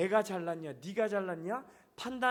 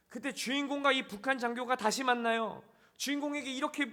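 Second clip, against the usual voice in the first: ordinary talk, nothing marked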